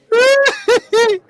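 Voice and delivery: laughing hard; loud high laugh. Real, not acted. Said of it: "You see the reporters' faces?".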